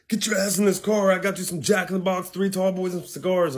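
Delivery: high-pitched, bossy mock order; imitated girlfriend. Girlfriend collecting him from jail, imitated bossy ordering. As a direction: lightly mimic a girlfriend ordering him about. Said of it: "She's like".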